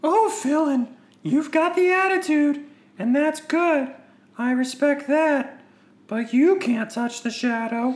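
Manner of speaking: high, put-on villain voice; Knights of Ren leader. Leader taunts a defiant brother, high villain voice. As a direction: draw it out high and theatrical.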